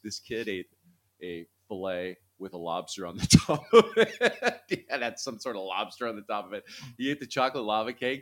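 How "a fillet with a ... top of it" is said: laughing. Real, not acted. Laughing about son's lobster steak.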